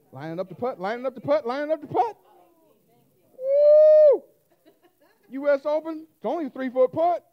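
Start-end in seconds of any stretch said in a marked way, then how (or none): 0.1-2.2 rising, louder tense call; play-by-play rising tension; building commentary. Acted. 3.4-4.2 very high, loud cheering whoop; hammed-up crowd woo.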